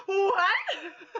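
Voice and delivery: high, loud laughing "What?"; shocked laughing outburst; laughing shocked yelp. Retold or imitated. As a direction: blurt it out in laughing disbelief. Shocked by daughter's remark.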